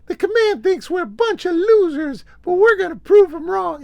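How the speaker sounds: very high, loud, urgent falsetto; rally the troops in a piercing high put-on voice; high-pitched pop star impression at full volume. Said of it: "[name] comes out and he's like".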